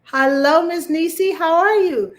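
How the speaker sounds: high, loud cheery hello; loud high greeting; bright loud greeting. Real, not acted. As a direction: sing out the hello to show the voice is back. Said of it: "you get the best of my voice right now".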